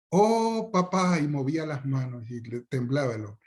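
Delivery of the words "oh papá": a child begging his father in a high, hammed-up plea; fawning child. Child flatters his father for ice cream, high mock plea. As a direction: overdo the child's reverent begging.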